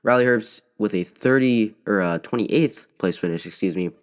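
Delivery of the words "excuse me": ordinary delivery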